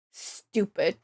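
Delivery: low, soft, defeated grumble; annoyed mutter. Real, not acted. Muttering after long angry rant.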